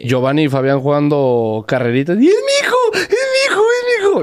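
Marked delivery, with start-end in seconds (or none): 2.2-4.2 very high, wailing sob on "es mi hijo"; mock sobbing parent. Retelling the documentary, a dad's hammed-up high sobbing wail. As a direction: cry it out like a proud weeping dad.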